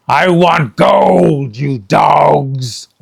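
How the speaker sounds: slow, loud greedy demand; Captain Bones demanding gold; demanding pirate captain. Acted.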